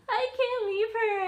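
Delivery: a half-mocking wail of not wanting to leave; tearful plea. To her husband, leaving the dog behind, a high mock-tearful wail.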